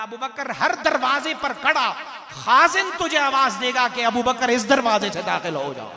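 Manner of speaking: loud, ringing proclamation; full-force preaching. Acted. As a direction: proclaim the quoted answer at full volume. Preacher proclaims quoted answer. (बुलंद आवाज़ में जोशीली तक़रीर)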